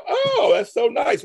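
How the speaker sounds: high, slow sugary praise; hammed-up fake admiration from family; mock family praise. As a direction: imitate a patronizing relative, high and drawn out.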